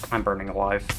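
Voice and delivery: ordinary talk, nothing marked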